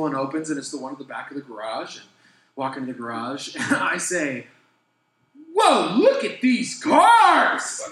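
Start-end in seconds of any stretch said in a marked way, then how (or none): 5.5-7.8 loud, high and drawn-out amazement; wide-eyed loud wonder; excited whoa. Retold or imitated. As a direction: shout it out at the fancy cars. Excited discovery in garage.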